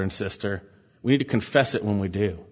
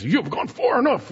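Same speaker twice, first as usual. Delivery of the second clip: a much higher, hammed-up sneering rebel line; kids-show villain; 10 semitones higher than usual. Preacher imitating a kids' Bible show rebel, hammed-up. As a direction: ham it up like a cartoon villain. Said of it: "those kids shows ... they make us".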